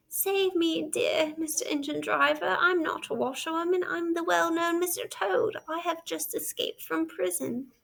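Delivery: desperate plea. Acted. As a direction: beg for rescue on your knees. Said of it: "fell on his knees and cried".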